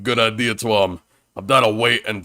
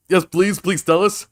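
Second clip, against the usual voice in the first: eager pleading; 7 semitones higher than usual. Acted. Eager pleading, raised pitch.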